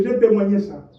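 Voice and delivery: normal talking voice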